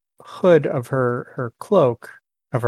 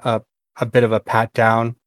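ordinary delivery

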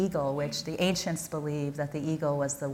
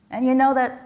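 playful laughing aside; 5 semitones higher, 6 dB louder than usual. Real, not acted. Joking aside about greedy prayer.

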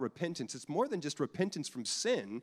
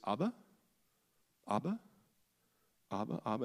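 put-on child calling Abba; 2 semitones lower, 4 dB quieter, faster than usual. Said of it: "households that spoke Aramaic".

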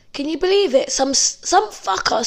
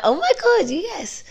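retold excitement; 7 semitones higher than usual. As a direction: act out sudden relieved joy. Retold joy at phone turned on.

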